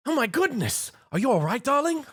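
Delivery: high, refined lady asking if he's all right; a posh lady fussing over him; Rarity's concerned voice. A narrator's reading. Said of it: "Rarity inquired".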